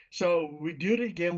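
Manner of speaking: plain reading voice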